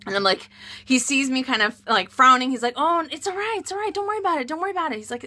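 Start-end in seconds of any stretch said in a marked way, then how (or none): 2.8-5.0 soft, higher-pitched hurried reassurance; placating, light and hushed; soothing husband. Retold or imitated. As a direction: imitate a husband gently calming his upset wife. Husband soothes her as she frowns.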